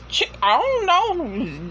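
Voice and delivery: normal talking voice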